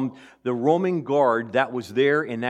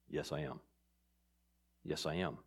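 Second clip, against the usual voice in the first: much softer and lower, a quiet yes; Jesus answering Pilate, hushed and sure; hushed affirmation; 11 semitones lower, 17 dB quieter than usual. Acted. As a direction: say it almost under the breath, certain.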